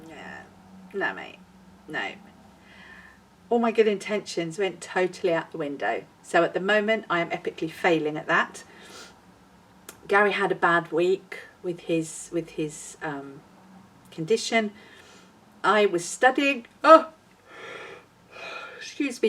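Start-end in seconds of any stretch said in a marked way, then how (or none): 0.1-2.1 low, quiet, deadpan refusal; much lower voice, softly turning someone down; Weight Watchers. Acted. Vlogger voices her diet plan refusing her, low and quiet. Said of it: "Weight Watchers is like". 16.8-19.3 yawning. Real, not acted. Vlogger interrupted while talking about studying, yawning. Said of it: "I'm yawning".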